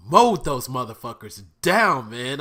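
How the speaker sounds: loud, high, thrilled outburst; yelling with pure hype; excited shout. Real, not acted. Raving to viewers about the fight, in an excited shout. Hyped recap of fight scene.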